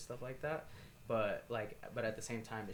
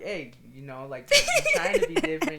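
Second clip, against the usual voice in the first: laughing, much higher and louder mock pep talk; mock encouraging; 7 semitones higher, 14 dB louder than usual. Host teasing the guest about being different, laughing mock pep talk. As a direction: squeal it out through laughter, teasing. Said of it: "You're like".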